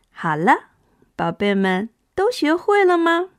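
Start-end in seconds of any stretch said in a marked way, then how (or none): none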